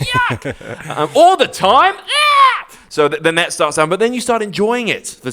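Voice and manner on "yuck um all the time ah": rising into a high, loud mock shriek; hammed-up horror at being stuck with the kids; exasperated parent. Parent stuck at home with the kids, mock exasperated shriek. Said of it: "you're sort of like".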